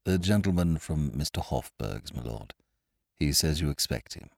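hushed, low-pitched butler. A narrator's reading. Butler discreetly announces a visitor to his lord, low voice. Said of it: "He spoke in a low voice".

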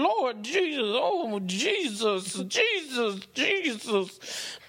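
drawn-out imitated praise between bites; mock praising Jesus. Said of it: "the popping of that and".

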